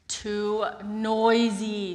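slow, loud, weary complaint; drawn-out complaint. Acted. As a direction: stretch out the grumble. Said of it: "said Peter".

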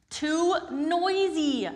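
high, slow, whining complaint; drawn-out complaint. Acted. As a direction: stretch out the grumble. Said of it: "said Peter".

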